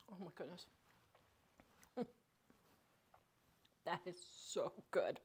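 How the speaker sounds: very soft, low murmur of delight; hushed savouring. Real, not acted. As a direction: savour the bite, almost to yourself. Savouring first bite of hot cornbread.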